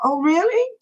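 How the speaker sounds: high-pitched, delighted thanks; pleased surprise. Real, not acted. Answering a viewer's kind comment on the live, pleased surprise. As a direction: lift the pitch in flattered surprise.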